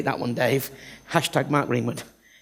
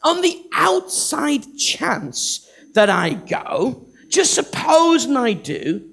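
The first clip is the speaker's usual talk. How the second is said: hammed-up nervous Moses; stammering Moses. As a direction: fumble and hedge like a reluctant man. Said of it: "Moses is saying".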